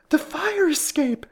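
high, loud, drawn-out cry; screaming. A narrator's reading. Miss Curley spotting the escape route, screaming. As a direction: cry out in alarm. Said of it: "screamed Miss Curley".

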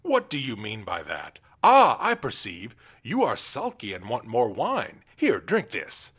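loud bullying royal voice; roaring tyrant king. Acted. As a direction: force the wine on him. King bullies jester to drink.

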